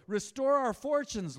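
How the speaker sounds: high, loud, slow plea; sermon proclamation. Acted. Pastor reading the psalm aloud to the church, slow and lifted. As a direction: cry it out slowly like a prayer.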